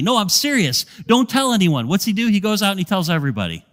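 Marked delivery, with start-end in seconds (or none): 0.0-1.9 stern warning. Retold or imitated. Jesus sternly warning the healed man, firm and raised. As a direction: lay down the law, pitched up. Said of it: "Jesus said sternly".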